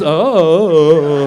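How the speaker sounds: long, drawn-out vacant "oh"; spaced out. Acted. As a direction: drone it like a zoned-out boy. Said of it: "boys get a little spaced out".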